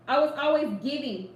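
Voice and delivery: ordinary delivery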